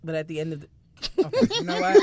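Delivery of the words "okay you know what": high, loud, exasperated; mock exasperation. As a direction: throw up your hands.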